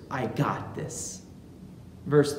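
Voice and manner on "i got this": low, much softer, quoted back with doubt; wry echo. Retold or imitated. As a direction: hand his boast back quietly. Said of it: "what does Jesus say in response to Saint Peter's".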